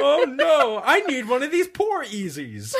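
high, laughing mock dismay; infomercial actress. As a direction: squeal it like a clumsy ad actress. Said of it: "her face is always like".

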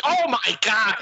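high, exaggerated Canadian-like Midwest vowels; ramped-up Midwest accent. Shown as a demonstration. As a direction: Midwest accent cranked to the max. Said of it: "the Midwest accent is, like, Canadian, but ramped up".

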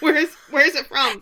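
high, loud baffled demand; incredulous mocking. Real, not acted. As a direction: press the question in mock outrage. Mocking the melodramatic diary lines.

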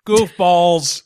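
Goofballs! shouted high and loud. Acted. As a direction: yell Goofballs! with a burst. Shouted repeat of phrase.

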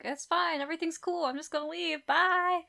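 high, airy imitation of reassurance; breezy brush-off. Voices Lucy brushing off the faun.

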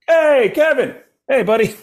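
high, loud mock-eager call; over-eager greeting. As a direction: hail your idol far too keenly. Acting out his over-eager greeting.

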